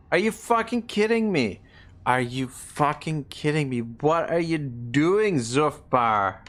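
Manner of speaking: real exasperated yelling, pitch jumping high; furious disbelief at an ally's betrayal; angry outburst. Real, not acted. Ally declares war, streamer erupts.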